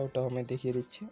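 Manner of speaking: normal talking voice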